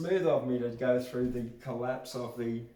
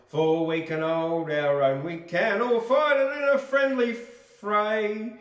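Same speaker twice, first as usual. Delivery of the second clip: rousing recitation; 7 semitones higher, 9 dB louder than usual. Acted. Reads a patriotic chorus aloud.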